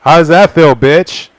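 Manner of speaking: high, loud gloating jeer; mock him with a laugh; gleeful teasing at a frustrated man; laughing taunt. Real, not acted. Reader taunts character, laughing.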